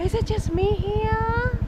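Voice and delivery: gasps then asks in awe; breath caught at the view; amazed gasp. Real, not acted. Arriving at stunning view.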